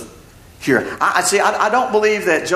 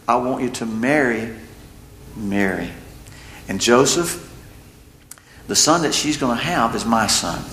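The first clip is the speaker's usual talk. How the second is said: angel speaking; 6 semitones lower than usual. Acted. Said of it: "the angel says".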